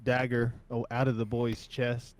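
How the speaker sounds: ordinary talk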